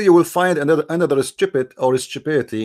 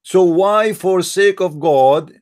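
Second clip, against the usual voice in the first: ordinary talk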